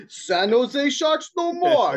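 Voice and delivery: slow, high mock Russian accent; hammed-up Russian proclamation. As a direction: declare it slowly in a comic Russian accent. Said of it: "I could do a good Russian accent".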